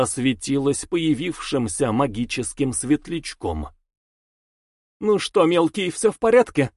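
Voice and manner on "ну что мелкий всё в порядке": high, teasing question with a grin; smirking. Acted. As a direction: tease the kid with a smirk. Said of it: "с усмешкой спросила девица".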